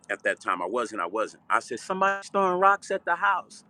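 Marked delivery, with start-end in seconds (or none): 1.8-3.6 put-on high, alarmed young voice; alarmed kid. Young boy telling his brother about rocks, high and alarmed. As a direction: blurt it out like a startled kid.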